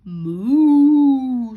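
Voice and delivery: long loud moo; cow moo. A narrator's reading. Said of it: "said the cow".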